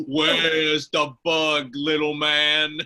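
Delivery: loom over him and taunt; hulking fish-man taunting Joe; monster thug. Acted. Monster Vinny menaces Joe.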